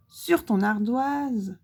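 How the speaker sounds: usual speaking voice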